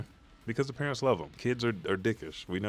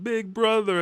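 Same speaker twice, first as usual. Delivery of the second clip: put-on high, loud boyish call; acted cry to brother; 5 dB louder than usual. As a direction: imitate a kid calling out, overcome. Boy calls rescuing brother, retold.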